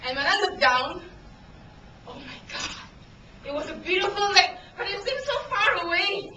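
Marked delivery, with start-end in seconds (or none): 2.1-2.8 hushed, acted dread; awed whisper. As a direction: whisper it as if looking down a terrifying drop. Looking down from the bridge edge.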